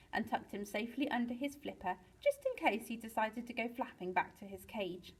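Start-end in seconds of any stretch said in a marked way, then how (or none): none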